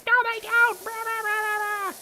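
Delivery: very high squeaky mock old lady. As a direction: parody a nagging old woman yelling. Neighbor lady yelling, retold mockingly.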